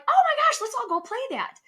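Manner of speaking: high squealing excitement, then drops back; excited squeal. Retold or imitated. On vacation, calling friends to play, excited squeal. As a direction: gush over the giant Jenga like a tipsy tourist. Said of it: "And I'm like".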